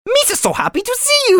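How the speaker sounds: very high squeaky Jar Jar. Pretend. Greeting friends as Jar Jar in a squeaky voice. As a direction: pitch way up like Jar Jar. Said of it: "bring the Gungans into this".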